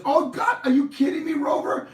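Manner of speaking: high, loud astonished cry; shocked owner voice; amazed disbelief. Acted. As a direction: gasp it out to the talking dog. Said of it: "And the guy says".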